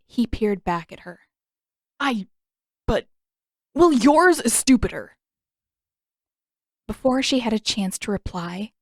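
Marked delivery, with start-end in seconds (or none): 2.0-5.2 stammering, flustered comeback; spluttering. Acted. Zuko spluttering back at Katara, flustered and louder. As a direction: trip over the words then blurt it. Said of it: "Spluttering again".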